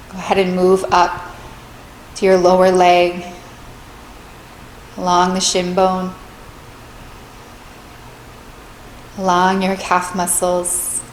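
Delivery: calm, measured meditation voice with long pauses; guided meditation. Real, not acted. Meditation teacher leading listeners through a body scan, calm and spacious.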